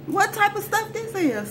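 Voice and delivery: high-pitched, held-up question; incredulous. Retold or imitated. Girl protecting him despite it all, incredulous high question. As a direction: ask it high in disbelief.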